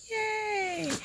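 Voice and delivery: high squealed yay; excited cheer. Real, not acted. Host cheering her own channel intro, squealing. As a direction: squeal it with delight.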